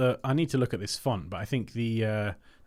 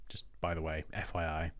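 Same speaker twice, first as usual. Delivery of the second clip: whispered aside; 6 semitones lower, 9 dB quieter than usual. Real, not acted. Aside to viewers.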